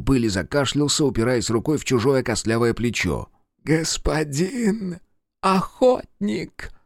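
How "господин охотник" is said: high, slow, cracking with delight; breathless rapture, voice breaking; broken, rapturous voice. Acted. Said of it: "Голос напротив звучал надломленно и восторженно".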